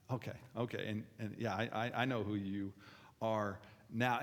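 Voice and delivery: quiet, low, halting write-off; dismissive judging. Acted. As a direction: trail through it quietly, mind made up. Acting out judging someone's beliefs.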